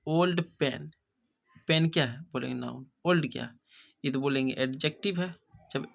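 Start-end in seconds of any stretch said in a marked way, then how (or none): 0.1-0.9 drawn-out, word by word; very slow example. Shown as a demonstration. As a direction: read "She has old pen" very slowly for students. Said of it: "कहता है कि". ("She has old pen" बहुत धीरे पढ़ो)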